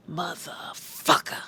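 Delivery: "Motherfucker" slow and whispery with a hard F; soft, slow "Motherfucker", biting the F. Retold or imitated. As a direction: stretch "Motherfucker", hitting the F hard. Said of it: "With the hard F".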